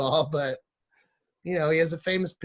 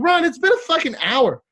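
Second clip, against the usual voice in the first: high, loud retold outburst; exasperated yell; 13 semitones higher, 7 dB louder than usual. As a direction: yell it like you're fed up waiting. Exasperated at waiting for Ron.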